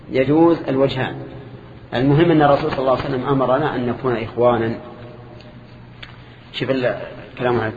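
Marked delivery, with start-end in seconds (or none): none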